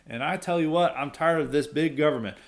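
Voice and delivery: a hint of a folksy Southern politician pitch. Jindal courting Southern voters, light Southern accent. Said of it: "act for them with a Southern voice".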